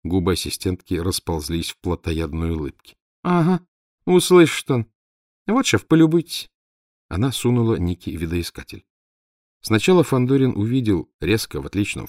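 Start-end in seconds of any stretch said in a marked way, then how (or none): 3.2-6.5 high, loud mocking girl's voice; gleeful sidekick laughing off the danger; mocking assistant. A narrator's reading. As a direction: scoff at the worry, then show it off. Assistant mocks, shows the view.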